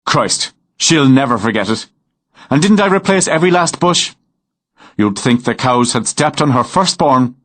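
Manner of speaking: a hint of an amused Irish lilt; Irish farmer Murphy. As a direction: genial countryman speaking. Narrator voices Irish farmer Murphy.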